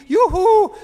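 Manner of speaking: very high, loud flasher's yoo-hoo; shouting yoo-hoo. Retold or imitated. Flasher shouts at passers-by, very high loud yoo-hoo. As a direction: squeal it out high to get attention. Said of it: "he's shouting".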